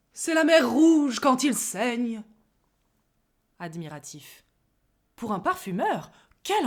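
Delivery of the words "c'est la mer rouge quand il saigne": slow, loud, tragic declamation; dramatic. Acted. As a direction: play it as high tragedy. (dramatique et théâtral)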